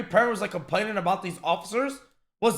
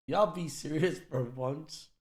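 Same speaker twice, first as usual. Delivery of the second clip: softer, slower weary plea; quiet plea; 5 semitones lower, 7 dB quieter, slower than usual. Real, not acted. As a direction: plead it quietly, slowly. Streamer repeats plea softer.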